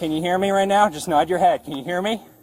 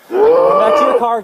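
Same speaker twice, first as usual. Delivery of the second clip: urgent yell, high and loud; man down shout; 9 semitones higher, 6 dB louder, slower than usual. Real, not acted. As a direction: shout it to a partner across the lot. Officer calls partner, injured subject.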